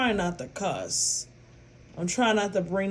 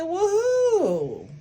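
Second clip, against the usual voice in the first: surprised; 10 semitones higher than usual. Retold or imitated. Retelling auntie's phone conversation.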